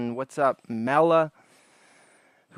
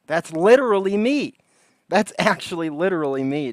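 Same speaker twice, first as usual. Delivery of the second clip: mock excitement; 5 semitones higher, 8 dB louder than usual. Mock self-identifying with clip.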